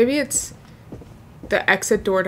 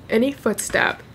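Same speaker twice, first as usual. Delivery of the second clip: ordinary talk, nothing marked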